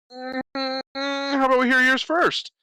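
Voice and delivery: high sing-song taunt; mocking Socrates. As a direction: toss the question back in a sly falsetto. Socrates voiced turning the question.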